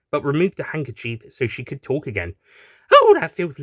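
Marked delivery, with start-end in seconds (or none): 2.9-3.6 gasp. Acted. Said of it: "she said with a gasp".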